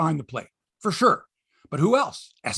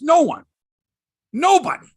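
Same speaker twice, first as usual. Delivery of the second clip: louder, higher incredulous protest; mock outraged media; 10 semitones higher, 7 dB louder than usual. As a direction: hammer it home like disbelieving reporters. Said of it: "The media's going".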